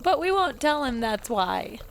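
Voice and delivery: ordinary talk, nothing marked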